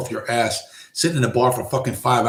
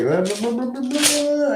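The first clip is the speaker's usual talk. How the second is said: much higher, singsong blah blah; mock blah-blah; 12 semitones higher than usual. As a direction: rattle off filler in a silly high voice. Mock filler talk.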